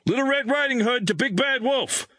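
high, insistent military distress call; urgent radio call. A narrator's reading. Major radios base before the crash, urgent and insistent. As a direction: call out code words urgently over the radio.

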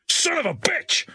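high-pitched furious curse; angry snap. A narrator's reading. Major curses as the engine dies, snapping angrily. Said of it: "the major snapped angrily".

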